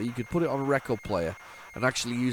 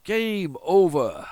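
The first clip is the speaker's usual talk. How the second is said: slow, high, loud announcer-style game over; dramatic game over; 7 semitones higher, 7 dB louder, much slower than usual. Pretend. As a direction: draw out game over like an arcade voice.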